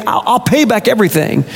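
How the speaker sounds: high, stammered promise; begging. A narrator's reading. Indebted servant promising the master repayment, begging. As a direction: plead with a slight stumble at the start. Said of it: "he begged".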